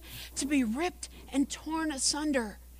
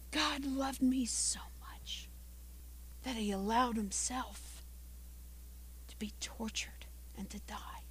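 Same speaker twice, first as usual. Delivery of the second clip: hushed reverence; 2 semitones lower, 14 dB quieter than usual. Real, not acted. Preacher reflecting on how much God loved, hushed. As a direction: let it sink almost to a whisper. Solemn reflection on Christ's suffering.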